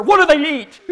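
high, loud, worried outburst; mock alarmed child. Scared child asking dad about squirrels, loud alarm. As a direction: cry it out like a scared kid. Frightened child asks father.

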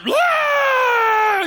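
high, loud retold wail of a crying boy; a hammed-up boyish cry; mock bawling wail. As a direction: wail out like a scared kid. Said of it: "I start bawling".

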